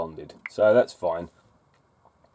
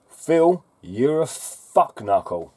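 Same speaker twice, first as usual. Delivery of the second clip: exasperated outburst; 6 semitones higher, 3 dB louder, slower than usual. Real, not acted. Calls out commenter loudly.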